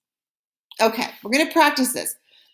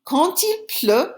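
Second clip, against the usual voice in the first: slow model reading; 5 semitones higher, slower than usual. Shown as a demonstration. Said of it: "Here's an example".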